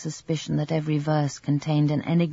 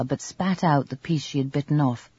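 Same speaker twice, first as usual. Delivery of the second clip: usual speaking voice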